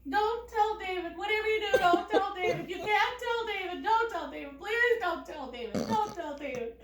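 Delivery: high, loud mock begging; insistent pleading, easing slightly toward the end; frantic pleading. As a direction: imitate a panicked mother begging over and over. Mother begging all the way.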